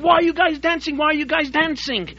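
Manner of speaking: loud, high-pitched angry demand; furious shouting. Retold or imitated. Prison guards shouting at dancing inmates, loud and furious. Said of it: "So they began shouting".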